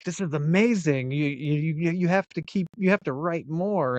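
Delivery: excited teacher. Retold or imitated. Teacher urging a student to keep writing, retold excitedly. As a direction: imitate an encouraging, excited teacher, voice lifted. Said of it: "she was, like, so excited about it".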